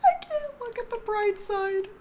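high, whiny mock cry; hammed-up pretend weeping; mock sobbing. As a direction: wail it like a pet who can't cope. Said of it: "She's like".

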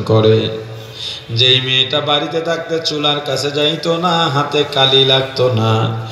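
low, plaintive sing-song narration; melodic preaching. Real, not acted. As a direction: intone the bride's hardship low, lingering on endings. Preacher intones bride's story. (নিচু করুণ সুরে ওয়াজ)

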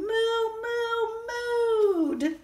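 the moos sung high like a cow, slowly; mooing cow. Acted. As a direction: draw out the moo like an excited cow. Cows mooing, excited.

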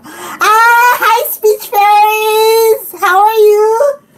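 high, drawn-out excited squeal; real giddy, stretched-out hello; excited greeting. Real, not acted. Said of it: "I'm so excited right now".

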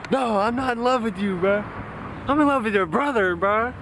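high-pitched imitation of himself; squeaky retold protest; mock high voice. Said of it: "And I was like".

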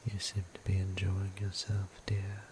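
ordinary talk, nothing marked

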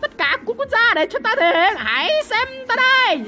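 high, showy boast climbing in pitch; excited, hammy champion call; grandstanding battle cry. Real, not acted. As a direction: clear everyone away for your big moment. Ordering others aside to show off.

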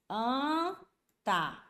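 ANTA very slow, a long nasal Ã, then TA. Shown as a demonstration. Teacher sounds out the phonetic spelling, very slow nasal vowel. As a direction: hold the first vowel of "anta" through the nose, then add "ta". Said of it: "Escrevendo bem devagar".

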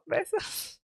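susurro agudo imitando un "besos" coqueto; imitando a la youtuber. Retold or imitated. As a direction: susurrado y agudo, como lanzando un beso. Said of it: "Hizo como". (imitación susurrada de la despedida de la youtuber)

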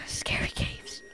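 hushed scared whisper; spooky whisper. Pretend. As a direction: whisper as if the cave hears you. Spooky whisper about caves.

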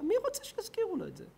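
high, fast mocking question; mocking high-pitched question. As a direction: squeak it out in mockery. Mocking the eulogy wish.

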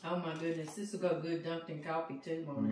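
talking through a mouthful; words round a bite of food; mouth full. Real, not acted. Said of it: "talking with my mouth full".

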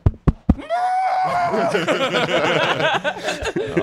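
long, very high wordless wail; mock scream. Woman stuck in bathroom, mock scream. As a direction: squeal like someone trapped in the dark. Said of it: "there'd be a pause and he'd, like".

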